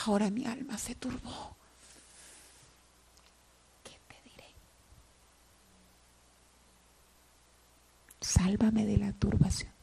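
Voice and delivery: anguished hushed whisper. Acted. Preacher voicing Jesus' troubled prayer, whispered. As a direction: pray it under your breath, troubled.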